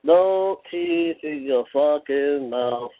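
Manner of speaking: slow deliberate jeer; slow mocking taunt. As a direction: drag each word out slowly. Taunt repeated slowly.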